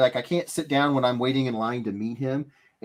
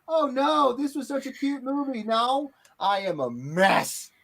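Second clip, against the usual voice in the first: much higher, hammed-up teary whine; mock distress; 10 semitones higher than usual. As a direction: play the weepy moviegoer for laughs. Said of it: "you're like".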